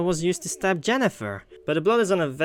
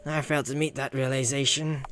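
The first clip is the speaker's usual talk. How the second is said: ordinary delivery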